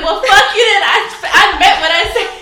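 high, very loud retort; hammed-up lovers' quarrel; mock angry outburst. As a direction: blast it back as a playful reenactment. Acted quarrel with boyfriend.

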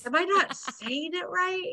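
high, baffled imitation; mock exasperation. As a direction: play someone who can't get through. Retelling super's exasperated question.

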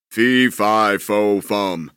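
a mild mock giant roar; giant's roar. Giant bursting into the kitchen, mock roaring. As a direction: boom it out like a giant. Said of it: "roared the giant".

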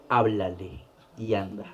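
low, slow, hushed command; voice of the slap. Retold or imitated. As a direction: give a soft, measured divine nudge. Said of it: "la cachetada me dijo". (orden lenta, grave y en voz baja)

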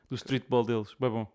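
ordinary talk, nothing marked

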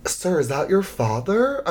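high, slow, careful question; mock-gentle cabin crew inquiry; concerned flight attendant. Flight attendant asks about the man.

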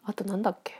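breathy murmur, thinking aloud; whispering to herself. Real, not acted. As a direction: whisper it to yourself. Muttering to herself between topics.

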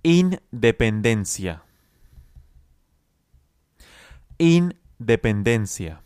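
slow, drawn-out Independencia with a falling end; teacher modelling a word slowly for students; slow model word. Shown as a demonstration. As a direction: stretch Independencia out slowly for the learner to copy. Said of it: "Intente repetirlo conmigo".

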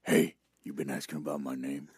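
low, quiet, fast tough-guy growl; low menacing inmate voice. Pretend. Voicing tough inmate Chain.

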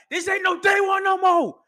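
high, loud yell in a put-on voice; furious shout. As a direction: shove him off with a scream. Roman shoving Jay.

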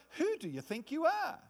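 accusing taunt. Pretend. Devil challenging the believer's authority, high sneer. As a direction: put them in their place. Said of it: "It's like".

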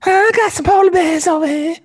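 loud, very high falsetto; alternative creep voice. Shown as a demonstration. Host lures guys inside to polar bears, in falsetto. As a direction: squeal the invitation in a silly high voice. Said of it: "But I would talk more like".

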